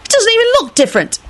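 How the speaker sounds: put-on high, irritable kid voice. A narrator's reading. Tim complains about the corpse, irritably. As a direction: complain high, speeding up at the end. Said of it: "Tim announced irritably".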